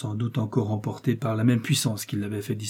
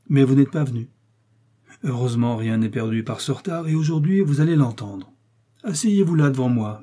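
raised, louder voice of a commanding cardinal; authoritative cardinal voice, pitched up; 3 semitones higher, 4 dB louder than usual. Acted. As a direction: speak down to the young man as the cardinal. Cardinal continues speaking.

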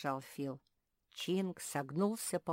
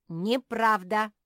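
high, loud, slow denial; curt denial; 6 semitones higher, 12 dB louder, slower than usual. A narrator's reading. Squirrel rejecting the bear's story, firm denial. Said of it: "отрезал Чинк".

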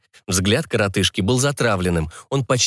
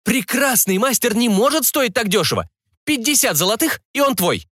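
high-pitched seller's counteroffer; indignant haggling; 12 semitones higher than usual. Acted. Seller refusing to sell a master cheaply, indignant. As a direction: haggle hotly in a raised pitch. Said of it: "возмутился тот".

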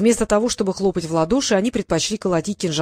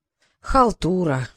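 weary sigh; 3 semitones lower, much slower than usual. A narrator's reading. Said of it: "вздохнул Гавр".

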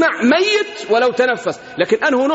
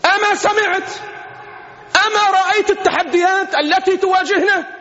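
urgent question; 6 semitones higher than usual. Retold or imitated. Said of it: "ونحن هذه اليوم نقولها".

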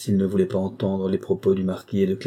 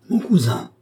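truly distressed; 9 semitones higher, 3 dB louder than usual. A narrator's reading. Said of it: "véritablement désolé".

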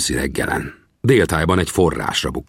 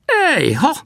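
sudden high cry of discovery; exclaiming call. Acted. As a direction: call out as you spot them. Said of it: "kiáltott fel".

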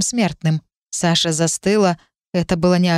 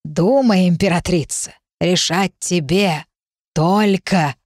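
put-on slow croaking old hag; croaking witch; slower than usual. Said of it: "прокаркав".

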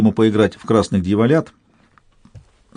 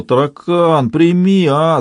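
whiny beggar plea; нытьё нищенки; 7 semitones higher, 3 dB louder, much slower than usual. A narrator's reading. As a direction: whine it like a beggar. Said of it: "заныл он, как нищенка". (ноет, как нищенка)

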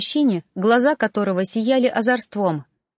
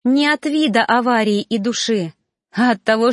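plain reading voice

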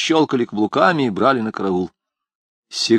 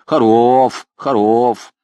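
toothless mumble; 5 semitones higher, 4 dB louder than usual. A narrator's reading. Toothless old man lisps.

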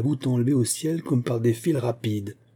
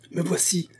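stammering; 8 semitones higher than usual. A narrator's reading. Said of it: "balbutia Porthos".

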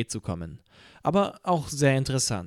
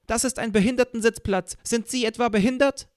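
high, loud scolding woman; an angry middle-aged woman scolding a stranger, high and loud; loud shrill fury; 10 semitones higher, 4 dB louder than usual. A narrator's reading. Middle-aged fury confronting the narrator on the train, loud high scolding. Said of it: "plötzlich, unerwartet, laut".

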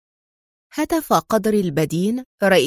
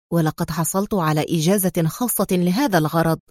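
usual speaking voice

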